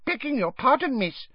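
very high, polite squeak; high-pitched servile elf; deferential house elf. A narrator's reading. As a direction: excuse yourself humbly in a tiny voice. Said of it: "said the house elf".

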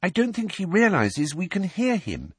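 put-on high, earnest girl's voice; anxious appeal, pitched right up; pleading. A narrator's reading. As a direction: plead on someone's behalf. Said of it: "Hermione pleaded".

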